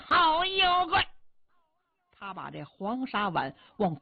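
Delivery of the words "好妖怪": high, loud challenge to the monster; battle shout. Acted. Yao Qi charging into the hall, high loud battle cry. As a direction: bellow it as he charges in. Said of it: "他进去的就喊".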